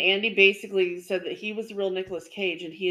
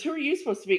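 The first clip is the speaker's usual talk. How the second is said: De Niro; 8 semitones higher, much faster than usual. Retold or imitated. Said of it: "Colin Quinn and says".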